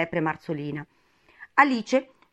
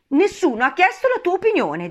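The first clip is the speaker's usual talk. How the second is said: indignant retort; 12 semitones higher, 7 dB louder than usual. A narrator's reading. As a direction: put him down indignantly. Alice snaps at the Hatter. (replica acuta e indignata)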